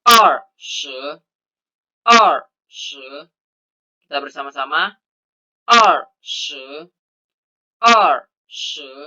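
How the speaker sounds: "R se" with er falling, shi rising; Mandarin twenty. Shown as a demonstration. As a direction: model "R se" tone by tone. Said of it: "Untuk cara bacanya".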